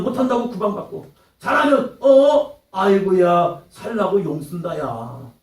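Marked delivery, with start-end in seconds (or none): 2.0-5.3 loud scoffing start, then slow sneering drawl; mocking sneer. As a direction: scoff at his effort, slow and snide. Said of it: "비아냥거리는 모습".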